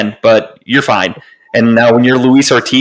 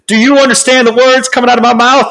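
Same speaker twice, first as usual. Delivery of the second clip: high, fast Chris Tucker voice from Rush Hour, pitched way up; Chris Tucker impression; 13 semitones higher, 3 dB louder than usual. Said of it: "In a perfect Chris Tucker accent".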